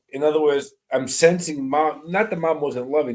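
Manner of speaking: ordinary delivery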